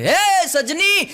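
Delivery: high, loud call to his wife; hamming up a husband yelling at dinner; mock shout. Husband shouting for his wife at the dinner table, mock yell. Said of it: "તરત રાડ પાડી કે".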